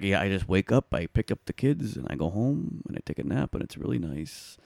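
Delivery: softly listed, easygoing imitation; calm. Cousin describing his bus route, calm. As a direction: let it trail gently, without a care. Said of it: "he sounded so calm".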